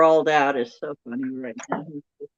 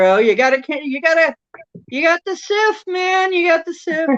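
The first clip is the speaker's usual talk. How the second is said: put-on high sing-song childhood taunt, much higher; mock teasing; 11 semitones higher, 5 dB louder than usual. Old friends teasing each other about the sif, high mock taunt. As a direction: needle your friend with a silly taunt.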